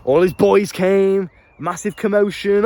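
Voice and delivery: normal talking voice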